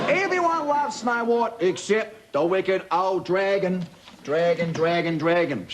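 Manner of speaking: dramatic echo fading on dragon. Acted. Narrator making the wicked dragon sound scary.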